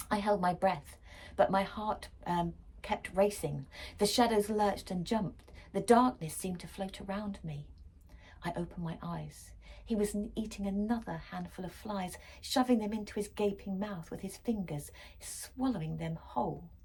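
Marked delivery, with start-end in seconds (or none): none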